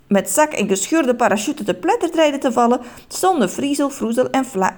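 ordinary delivery, nothing marked

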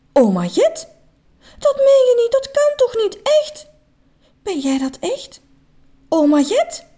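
high, disbelieving cry; can't believe his eyes; astonished, near tears. A narrator's reading. Grown man seeing his late grandma again, high and tearful.

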